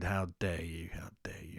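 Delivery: hushed, low mock outrage; mock-offended whisper. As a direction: whisper it as if scandalised. Mock offence at accent criticism.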